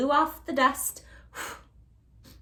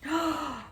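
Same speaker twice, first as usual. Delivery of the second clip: gasp; 6 semitones higher than usual. Acted. Witch gasps at idea.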